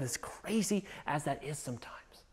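hushed, breathy aside; whisper aside. Real, not acted. Preacher to church, whispered aside. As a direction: drop to a whisper.

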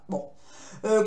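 usual speaking voice

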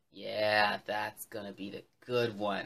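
very low, pleased bear voice; low, satisfied rumination; bear thinking to himself. Acted. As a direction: think it aloud in a deep bear voice. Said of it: "and he thought".

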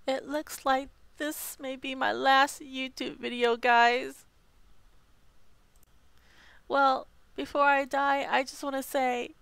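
a hint of a dying farewell; bleeding out. Dying farewell after hamster bite.

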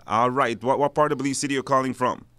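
ordinary talk, nothing marked

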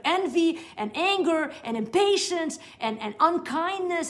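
ordinary talk

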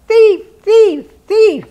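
very high, loud bird cry; bird yelling. Acted. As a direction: screech it out like an angry jay. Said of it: "and yelling".

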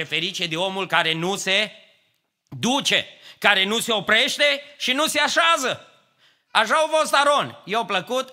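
fervent preaching. Acted. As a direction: drive the warning home to the church. Psalm quote builds to climax. (predică înflăcărată, mai sus și mai tare)

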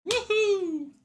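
high whoop, then laughter; joyful whoop. Real, not acted. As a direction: whoop it out in delight. Celebrating the win.

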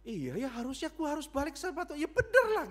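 high-pitched voice of dawning insight; sudden realisation. Retold or imitated. Teenager admitting to the pastor they should return, high-pitched realisation. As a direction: act out a student waking up, climbing to a loud high peak. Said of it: "Wah, dia sadar".

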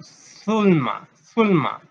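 Zulma said loud, leaning on the opening Z as an s sound; stressed initial Z. Shown as a demonstration. Teacher shows pupils where Z goes, Zulma with stressed opening Z. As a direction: sound out Zulma emphatically, Z first. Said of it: "Zulma con Z al inicio".

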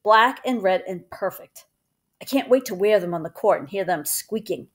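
usual speaking voice

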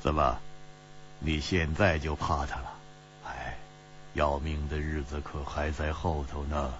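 low, soft, knowing tease; amused teasing. A narrator's reading. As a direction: tease him quietly and very low. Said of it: "齐冰燕笑着说".

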